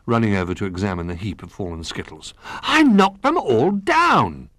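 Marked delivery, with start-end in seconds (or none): 2.6-4.5 high, loud, drawn-out crowing; triumphant cry. A narrator's reading. Little Bear surveys the fallen skittles, crowing triumphantly. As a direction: crow slowly and proudly in a high voice.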